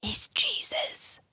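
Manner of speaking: slow, hushed whispered reveal; whispering. Real, not acted. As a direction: whisper it like a big secret. Joking reveal of plot device.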